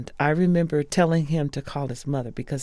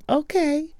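much higher, louder okay; imitating Patti; 5 dB louder than usual. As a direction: squeak out a high eager okay. Patti agreeing, very high.

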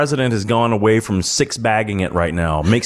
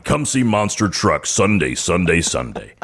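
booming truck rally announcer voice; monster truck ad; 7 semitones lower than usual. Pretend. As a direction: hype it like a monster truck promo. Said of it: "I have to use that voice like it's a Sunday truck rally".

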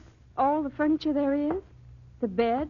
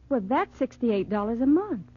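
usual speaking voice

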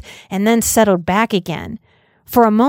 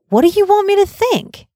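put-on higher child's voice, curious and eager; small boy voice; 11 semitones higher, 4 dB louder than usual. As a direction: voice a young boy asking innocently. Child Danny asks Halloran.

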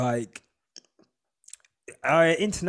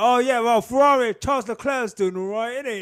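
higher and louder, hammed-up English bloke; mock British accent; 6 semitones higher, 5 dB louder than usual. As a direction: put on a stupid British tourist voice. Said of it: "I'm like".

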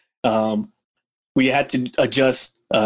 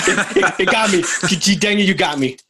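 laughing, pitched up; amused laughter at a shared joke; 6 semitones higher than usual. Real, not acted. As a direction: laugh through the words.

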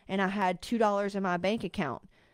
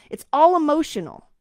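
louder rant; 5 semitones higher, 8 dB louder than usual. Real, not acted. Ranting at clients.

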